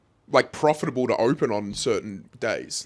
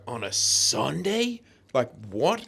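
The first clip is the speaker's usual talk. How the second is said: incredulous; 4 dB quieter, slower than usual. Retold or imitated. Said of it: "the guy looked at him and was like".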